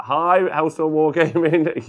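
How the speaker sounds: high, drawn-out loud hello; loud greeting. Real, not acted. Streamer hailing a viewer joining the chat, loud greeting. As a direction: call out a big stretched welcome.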